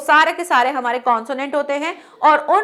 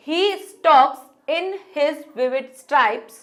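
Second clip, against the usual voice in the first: slow poem reading; much slower than usual. Shown as a demonstration. Teacher showing students the poem, slow and measured. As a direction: read each line slowly, word by word. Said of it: "जैसे यहाँ दे रखा है".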